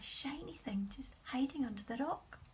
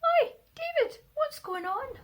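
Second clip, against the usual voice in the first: calling out; 11 dB louder than usual. Acted. Bird calls down to boy.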